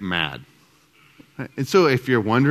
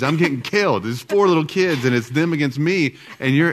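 resentful nagging; 3 semitones higher than usual. Retold or imitated. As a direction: play the overworked wife lashing out. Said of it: "I probably heard".

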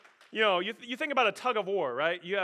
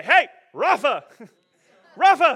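loud, higher sharp parental command; 7 semitones higher, 16 dB louder than usual. Pretend. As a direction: bark the word rapha at the kids.

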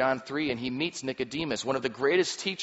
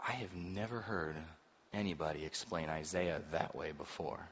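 awed hush; 8 semitones lower, 12 dB quieter than usual. Acted. Amazed priest voiced.

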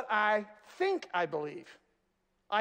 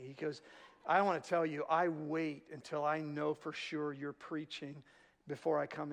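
low, soft, nervous confiding; hushed confession; 7 semitones lower, 6 dB quieter than usual. Retold or imitated. Friend quietly confessing fear of the church greeters, hushed. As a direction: confess it quietly, a little spooked. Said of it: "Rick confessed to me".

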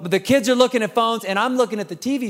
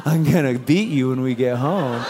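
low, laughing mock threat through a fake smile; mock menacing parent aside; 8 semitones lower than usual. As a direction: hiss the threat to the kid under your breath, half joking. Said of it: "We're like".

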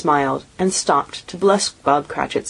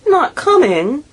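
much higher, louder dismayed question; dismayed surprise; 11 semitones higher, 4 dB louder, slower than usual. A narrator's reading. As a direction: Bob crestfallen at the news. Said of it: "with a sudden declension in his high spirits".

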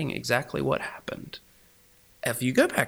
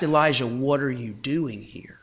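tenderly. Shown as a demonstration. God tenderly asking Elijah, whispered. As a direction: ask it like a gentle father. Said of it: "he asked it very tenderly".

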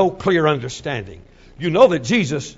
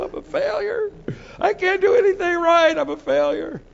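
much higher, pleading wail of failure; anguished high cry; 13 semitones higher than usual. Retold or imitated. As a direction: let it climb into a high, despairing plea. Peter's despair, voiced in sermon.

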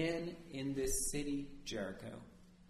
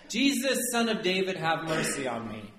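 hollering; 3 semitones higher, 5 dB louder than usual. Acted. Blind Bartimaeus calling to Jesus over a crowd, hollering. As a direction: call out for mercy, easing off toward the end. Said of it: "begins hollering".

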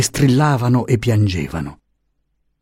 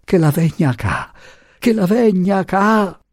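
high wailing plea, rising higher; frantic repeated plea; 9 semitones higher than usual. A narrator's reading. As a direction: keep begging, stretching the repeat. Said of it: "Ed esse sapevano solo ripetere".

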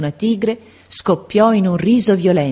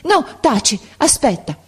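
high, urgent interruption; abrupt, pressing command; sudden change of tone; 9 semitones higher than usual. A narrator's reading. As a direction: cut yourself off urgently. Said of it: "cambiando tono improvvisamente".

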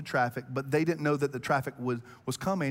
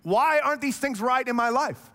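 higher, louder frustrated whine at God; mock grievance, pitched up; frustrated complaint; 8 semitones higher, 3 dB louder than usual. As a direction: complain like you're owed an answer. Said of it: "I point the finger at him and go".

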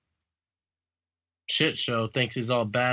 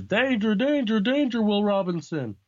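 high, urgent mock alarm; alarm warning; 9 semitones higher, 4 dB louder than usual. Reading a comic aloud to a co-host, mock alarm. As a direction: sound a hammed-up warning, pitched well up. Acting out a famous warning quote.

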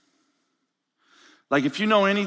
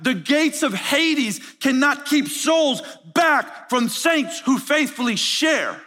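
preaching at full force; 6 semitones higher than usual. Acted. Preacher proclaiming the rock and the church, building to a shout. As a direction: proclaim it like a rising altar call.

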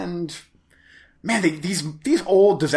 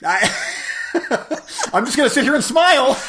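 laughing; 12 semitones higher than usual. Real, not acted. Laughing while embarrassed.

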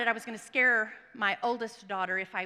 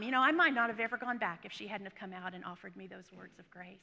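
normal talking voice

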